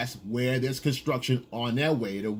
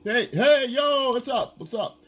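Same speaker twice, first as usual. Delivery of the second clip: high, pushy "hey yo" holler; catcaller calling out; 10 semitones higher, 4 dB louder, slower than usual. Retold or imitated. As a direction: mimic a lone guy hollering for attention. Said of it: "You yelling out".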